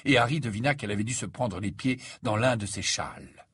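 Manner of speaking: usual speaking voice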